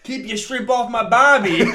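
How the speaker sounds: loud, high, laughing Aussie cliché; hammed-up Australian accent. Joking with friends in a loud, laughing Australian accent.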